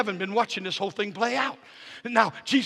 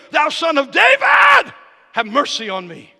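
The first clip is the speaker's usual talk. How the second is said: crying out louder; 6 semitones higher, 10 dB louder than usual. Acted. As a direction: cry it out over the crowd. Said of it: "but he cried the more".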